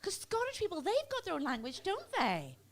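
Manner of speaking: high, put-on lady voice, marvelling; amazed dentist lady. Dentist lady wondering about Scottish people, high amazed voice. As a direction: reason it out brightly in a high voice. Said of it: "She said".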